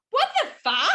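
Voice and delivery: high, loud shocked "what the fuck"; shocked outburst. Retold or imitated. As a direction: blurt it out in disbelief. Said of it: "they went".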